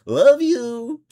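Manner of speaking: high, loud mock holler; playful shout. Host jokes about the banned phrase, playful shout. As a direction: sing out "love you" way up high, hammed-up. Jokingly calls out the dropped phrase.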